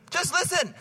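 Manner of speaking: high, pleading mock wife; wife's plea. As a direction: beg him to just listen. Voices wife's plea to husband.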